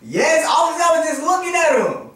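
defensive insistence. Real, not acted. Insisting on grades against doubt.

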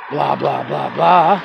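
ordinary delivery

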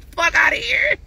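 high scornful yell; shout. Real, not acted. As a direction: yell it off at him. Ranting at ex on livestream.

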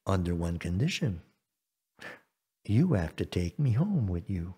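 low, soft frog voice; hushed, low-pitched negotiator frog; bargaining frog. Acted. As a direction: lay down terms quietly and low. Frog sets his condition.

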